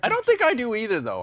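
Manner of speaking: normal talking voice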